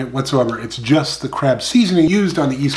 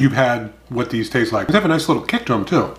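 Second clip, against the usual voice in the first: usual speaking voice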